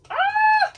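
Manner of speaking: very loud, high-pitched frustrated scream; sudden exasperated shriek. Real, not acted. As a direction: let out a piercing yell of frustration. Frustrated at forgetting lyrics.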